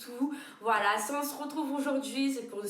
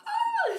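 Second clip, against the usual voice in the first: shocked high shriek; 6 dB louder than usual. Real, not acted. Outraged at threat.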